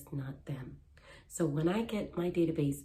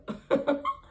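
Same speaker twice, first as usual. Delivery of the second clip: laugh; 9 semitones higher, 6 dB louder than usual. Real, not acted. Laughs after baby story.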